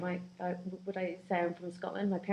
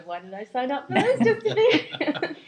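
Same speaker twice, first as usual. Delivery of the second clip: loud, higher, laughing complaint; real amused exasperation, raised voice; 12 semitones higher, 12 dB louder than usual. Real, not acted. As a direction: laugh through a joking protest. Joking regret amid shared laughter.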